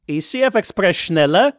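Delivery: loud, playful German echo. Real, not acted. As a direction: call it out with a grin. Playfully echoes the German word.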